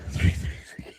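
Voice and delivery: whispered aside with a laugh; whispered echo. Pretend. Co-host echoing the three threes a game line, whispered. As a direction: repeat it in an amused whisper.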